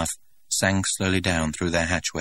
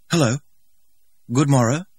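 put-on higher, louder odd old-fashioned greeting; peculiar English; 8 semitones higher, 6 dB louder than usual. A narrator's reading. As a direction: greet them in a stilted antique way. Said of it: "in faultless but peculiar English".

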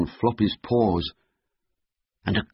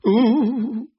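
high, wavering frightened "ooh"; scared "ooh"; 12 semitones higher, 6 dB louder than usual. Acted. Floppy is scared of the wolves, frightened wail. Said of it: "Floppy was scared of the wolves".

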